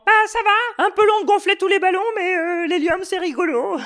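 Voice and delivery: very high squeaky helium voice. Pretend. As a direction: speak like you inhaled helium. Rover speaking on helium.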